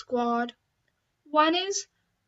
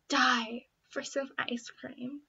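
breathy secret whisper; 5 dB quieter than usual. Acted. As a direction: confess it under your breath. Secret craving on a diet.

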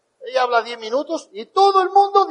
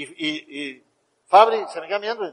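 normal talking voice

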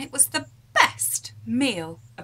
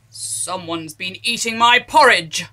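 mild growl; 3 semitones lower, 10 dB louder than usual. Acted. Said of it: "growled Daddy Bear".

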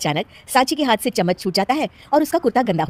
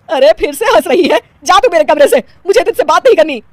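louder, higher indignant scold; angry scolding; 9 semitones higher, 10 dB louder than usual. Acted. Mother-in-law ordering the giggling bride out, angry. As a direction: send her out of the room in a huff.

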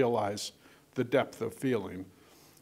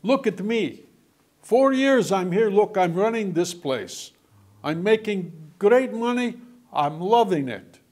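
loud, high, emphatic boasting; retold proud testimony; 7 semitones higher, 8 dB louder than usual. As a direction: imitate a proud immigrant insisting on his success. Retelling an immigrant's proud declaration.

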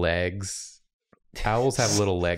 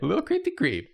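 high teasing voice; 11 semitones higher, much faster than usual. Acted. Teasing the slip.

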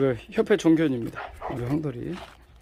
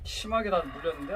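usual speaking voice

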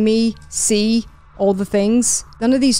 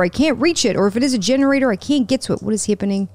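ordinary delivery

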